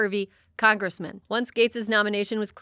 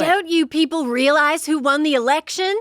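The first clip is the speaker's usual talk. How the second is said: high, loud, exaggerated Tampa accent; atrocious Tampa accent; 7 semitones higher, 9 dB louder than usual. Mocking Pam Bondi's Tampa accent at an official, loud. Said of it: "in her atrocious Tampa accent".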